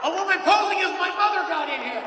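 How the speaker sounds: high, loud, laughing exclamation; laughing shout. Real, not acted. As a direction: shout the joke back through laughter. Joking about a heckler.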